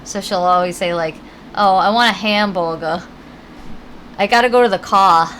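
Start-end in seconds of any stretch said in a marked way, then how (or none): 1.6-3.0 "hamburger" with no R's, HAM-boo-guh; R-less child speech. Retold or imitated. Mother imitates daughter's speech impediment, R-less hamburger. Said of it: "she can't pronounce her R's". 4.2-5.3 "car" with no R, CAH, said loud; R-less child speech. Retold or imitated. Mother imitates daughter who sounds from Boston, R-less car. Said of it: "she can't pronounce her R's".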